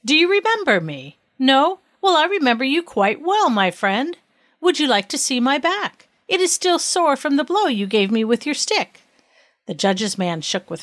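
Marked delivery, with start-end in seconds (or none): 0.0-9.0 higher, taunting questions; pointed confronting. A narrator's reading. King confronting the man who struck him, taunting. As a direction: needle him with each pointed line.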